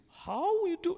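put-on very high, squeaky Indian-accent try; a comic attempt at an Indian accent; mock Indian accent. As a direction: toss off a jokey Indian accent. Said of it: "I wanted to speak in Indian language".

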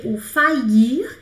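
"faillir" said very slowly, rising; slow French faillir. Shown as a demonstration. Teacher introducing the French verb faillir, slowly. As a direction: draw out "faillir" for the learners. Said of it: "new French verb, drawn out".

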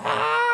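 high-pitched hammed-up scream; overacted victim's shriek; mock scream. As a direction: scream in comic agony.

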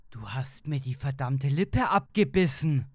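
loud, indignant outburst; shouted accusation. A narrator's reading. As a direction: cry out in outrage at the wife. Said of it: "rief ich".

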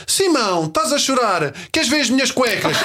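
put-on high little girl comforting a crying boy; sweet toddler. Daughter tries to cheer crying classmate, high child voice. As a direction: coax him like a tiny girl. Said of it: "ela chega-se ao pé do miúdo e diz".

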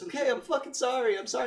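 very high-pitched pleading; hammed-up exasperated sorry; mock frantic apology. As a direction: imitate a desperate woman giving in.

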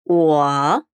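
low dipping tone; 我 as wǒ, low dipping third tone; Mandarin wǒ. Shown as a demonstration.

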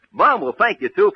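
ordinary talk, nothing marked

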